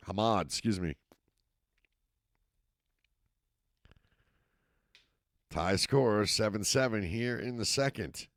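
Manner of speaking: steady live football call, rising with the action; play-by-play commentary. Acted. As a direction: call the game as it happens. Play-by-play resumes.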